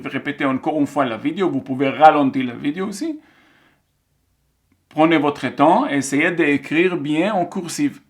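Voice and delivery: ordinary talk, nothing marked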